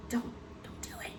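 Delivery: breathless hushed whisper, pitched high; urgent whisper. Retold or imitated. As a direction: whisper a plea so nobody else hears. Silently begging husband across the table.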